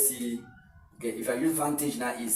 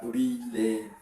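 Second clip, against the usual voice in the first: "relative" stretched into re, la, tive; slow, separated syllables of "relative"; slower than usual. Shown as a demonstration. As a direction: pause between the parts of "relative". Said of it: "a better, clearer".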